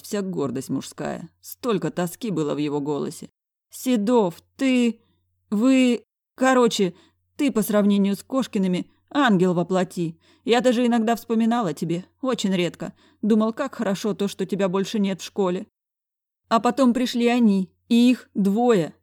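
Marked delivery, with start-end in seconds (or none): 3.7-4.4 higher, louder headmaster saying the name; old headmaster calling him; pleading director. A narrator's reading. 4.6-18.9 higher, louder headmaster, slowing to a lament at the end; a broken man confessing; higher lamenting old man; despairing director. A narrator's reading. Director complains about twins.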